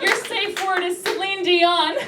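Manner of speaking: high, slow, sing-out announcement; polite dominatrix. Acted. Canadian dominatrix instructing a banker, high and slow.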